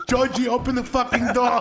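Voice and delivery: high, loud yelling through laughter; a laughing imitation of an angry grandma; mock yell. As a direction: bang on the door and holler at Georgie. Grandma yelling at bathroom door.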